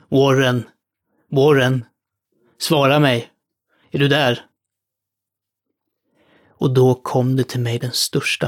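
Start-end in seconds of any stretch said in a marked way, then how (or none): 0.1-4.5 louder, desperate shouts into the phone; shouting. Acted. Carter shouts desperately down the line to Warren. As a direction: yell his name into the dark. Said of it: "skrek och vrålade".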